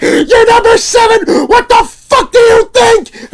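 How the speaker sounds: slow, very high, full-force yell; furious shout. Retold or imitated. Vendor snapping at customer at the booth, furious shout. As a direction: blow up at the customer, screaming each word out. Retold losing temper at customer.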